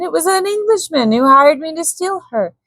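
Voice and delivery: a frightened captive, pitched high and pleading; cowering Swede. A narrator's reading. Malbin pleads with threatening Korak, frightened and high. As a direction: beg for your life while blaming another.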